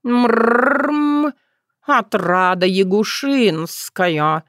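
purring cat announces solemnly; slow, grand feline introduction; solemn announcement. A narrator's reading. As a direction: roll into a long purr, then stretch out the name. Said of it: "произнёс торжественно".

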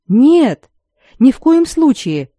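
indignant. A narrator's reading. Accused Itlian denying collusion with chancellor's men, indignant. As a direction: protest as if sincerely affronted. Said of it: "возмутился итлиец вроде бы искренне".

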